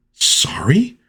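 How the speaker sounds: high-pitched incredulous question; mock disbelief. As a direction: echo it high, as if you can't believe it. Bystander echoes apology in disbelief.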